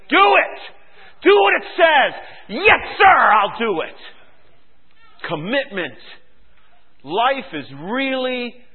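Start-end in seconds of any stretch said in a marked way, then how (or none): 0.1-2.1 very high, loud pulpit order; commanding shout. Real, not acted. Preacher commanding congregation. 2.5-4.0 loud soldier's reply, high then falling; obedient shout. Acted. Acted obedient answer.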